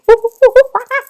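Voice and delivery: a quick, high, silly chimp screech; mock monkey hoot. Host apes a chimpanzee for the trivia answer, hammed-up hoot. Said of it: "That was a horrible monkey impression".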